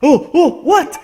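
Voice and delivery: sudden high, loud gasp of surprise; surprised. Real, not acted. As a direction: sound amazed it worked. It suddenly worked.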